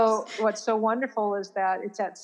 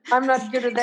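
laughing; 5 semitones higher, faster than usual. Real, not acted. Laughing along at shared joke.

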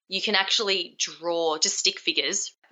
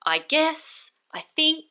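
unsure filler; 9 semitones higher, slower than usual. Shown as a demonstration. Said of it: "filler words like".

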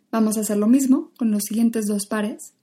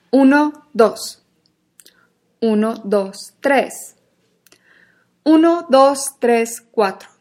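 louder, counting thrown across the room; projected voice; 7 dB louder, much slower than usual. Shown as a demonstration. Teacher projecting numbers to a spot in the room. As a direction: send your voice to a far point. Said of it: "mandar toda nuestra voz y energía hacia ese punto".

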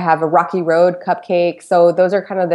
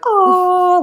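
a much higher gasp of delight; amazed oh; 12 semitones higher, 3 dB louder than usual. Retold or imitated. Opening the oven to perfect cupcakes, amazed higher oh. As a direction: gasp as the perfect batch appears.

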